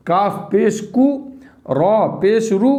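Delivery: slow, deliberate letter-by-letter spelling; slow spelling drill. Shown as a demonstration. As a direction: drill it slowly for children. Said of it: "इस तरीके से".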